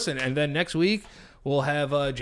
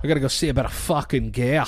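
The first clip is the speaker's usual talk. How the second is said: Boston accent impression; 4 dB louder than usual. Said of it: "Sound like Sean Connery".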